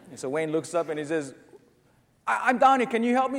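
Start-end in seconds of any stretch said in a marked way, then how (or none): 2.3-3.4 high, loud urgent call; raised voice from down below; calling up for help. Acted. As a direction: call up eagerly to a distant rescuer. Man on cliff answers voice above.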